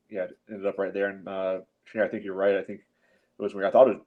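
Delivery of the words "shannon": ordinary talk, nothing marked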